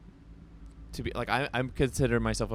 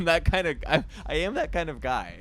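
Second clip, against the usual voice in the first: higher, louder, through a laugh; laughing confession; 7 semitones higher, 6 dB louder than usual. Real, not acted. Musician confesses crying to Miku in the car, laughing. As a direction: own up with an embarrassed laugh.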